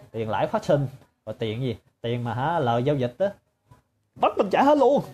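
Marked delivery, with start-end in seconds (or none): 4.1-5.0 high, loud outraged cry; indignant outburst. Real, not acted. Speaker venting about the repayment terms, high and loud. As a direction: burst out in disbelief.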